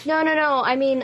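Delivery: ordinary talk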